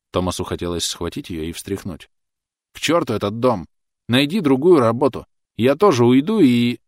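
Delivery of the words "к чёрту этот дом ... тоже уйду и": higher, louder desperate shouting; outburst. A narrator's reading. Thomas shouting at Clotilde to quit the house. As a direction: shout it all out at her.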